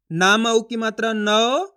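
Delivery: slow chant, नौ stretched on a rising glide; syllable spelling drill. Shown as a demonstration. Teacher spelling a word for learners, drawn-out and rising. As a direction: spell it out, then hold the au of नौ upward. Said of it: "इसी तरीके से आपको उच्चारण करना है".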